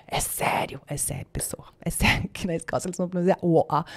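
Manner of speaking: hushed, whispered disbelief; whispered aside to the audience; mock whisper. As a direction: lean in and whisper it in mock shock.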